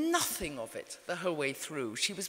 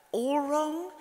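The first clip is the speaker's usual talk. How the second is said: higher, rising "All wrong?" in disbelief; shocked disbelief; 9 semitones higher than usual. Retold or imitated. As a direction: echo her words back, stunned and high. Shocked reply to mother's verdict.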